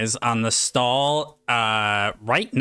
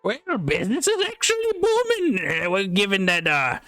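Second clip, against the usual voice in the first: high-pitched pirate merchant; 5 semitones higher than usual. Acted. Pirate stallkeeper boasts of his magic trade, pirate voice. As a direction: pitch it up like a pirate peddler boasting, drawing out the last words. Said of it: "this guy talks like a pirate".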